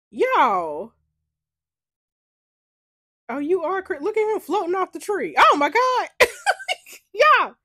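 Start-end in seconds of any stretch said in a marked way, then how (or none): 5.4-7.5 shocked laugh. Real, not acted. Shocked reaction while playing.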